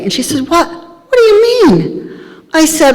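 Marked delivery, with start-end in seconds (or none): 0.5-2.1 shocked disbelief. Retold or imitated. Friend shocked by her denial of God.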